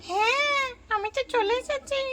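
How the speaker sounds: put-on high eager little girl arriving to play; child voice. Babli calling for Piya, high child voice. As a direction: lift the voice up like an excited young cousin.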